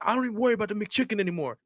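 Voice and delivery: high, quick imitation of an irate man; mock belligerent customer. Customer brushing off the manager's offer, mock belligerent. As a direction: rattle it off high, picking a fight. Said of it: "Literally just being an asshole".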